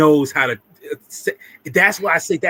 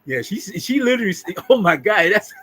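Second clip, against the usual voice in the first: ordinary talk, nothing marked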